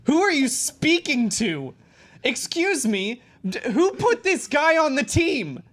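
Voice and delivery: loud, high shouting outrage; angry captain. Acted. A fed-up police captain yelling at his crew at a murder scene, loud and high. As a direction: bellow at the whole squad in disbelief.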